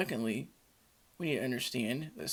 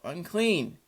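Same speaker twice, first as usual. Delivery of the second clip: raised, louder cry of warning; yelled warning; 6 semitones higher, 7 dB louder than usual. Acted. Unclean person warning anyone who approaches, yelled out. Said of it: "they are to yell out".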